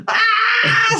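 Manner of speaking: a squealed "Ah!" through laughter; real helpless laughing yelp; high laughing shriek. Real, not acted. Cracking up while reading.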